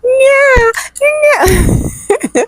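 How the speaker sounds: put-on high wailing baby cry; mimicking a baby's first cry; imitated newborn cry. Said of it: "the next thing I heard was".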